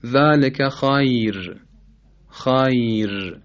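drawn-out خیر ending on a thin r; light ra. Shown as a demonstration. Teacher demonstrating tarqiq at a stop, thin r ending خیر. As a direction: stretch خیر slowly, finishing on a light r.

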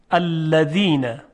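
الذین slowly, with a voiced th on ذ; dhal in a word. Shown as a demonstration. Teacher repeating an example word, slow careful الذین. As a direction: stretch الذین, tongue tip on the teeth. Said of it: "مثلاً در کلمهٔ".

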